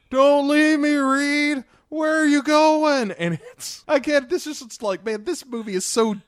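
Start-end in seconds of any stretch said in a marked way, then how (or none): 0.1-3.1 very high, loud whiny plea; desperate pleading. Retold or imitated. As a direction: wail after him, abandoned. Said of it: "Ben is just like".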